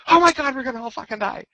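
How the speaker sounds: high, fast panicky wail; mock a leader losing it in battle; panicked freak-out. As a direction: fleet commander panicking that everyone will die. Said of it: "suddenly goes".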